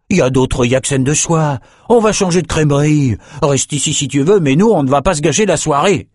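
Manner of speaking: impatient companion. A narrator's reading. Brock urges Amos to go elsewhere tonight, impatient. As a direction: push him to move on.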